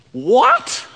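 sharp, high disbelieving what; mock surprise. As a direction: react as if shocked by John. Reacting to John worshipping angel.

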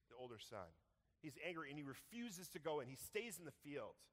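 ordinary talk

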